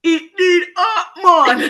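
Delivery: slow, loud, high cartoon Jamaican accent; mock Jamaican accent. Mocking what classmate expected to hear, fake Jamaican accent. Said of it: "what do you think I was gonna say".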